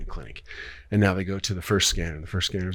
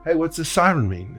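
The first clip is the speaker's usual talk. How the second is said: calling out; 9 semitones higher, 5 dB louder than usual. Retold or imitated. Passer-by calls out a question.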